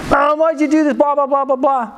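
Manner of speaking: high, whiny complaint; hammed-up kid wailing at a parent; mock angry. Kid home from school blames his mom, whining angrily. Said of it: "I'm kinda angry about it".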